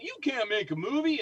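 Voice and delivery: high, loud mock yell of a scornful boss; imitated yelling. Haunted house owner yelling at a caller over the phone, mock yell. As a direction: snap it at him like he's being ridiculous. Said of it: "just started yelling at me".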